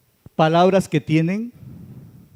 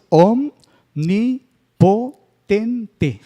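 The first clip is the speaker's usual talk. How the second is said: "omnipotente" drawn out very slowly, each syllable separated; split into syllables; 3 semitones higher, 4 dB louder, much slower than usual. Shown as a demonstration. Teacher showing students the syllable division, slow and separated. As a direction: break "omnipotente" apart syllable by syllable for the class. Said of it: "la división de, de las sílabas".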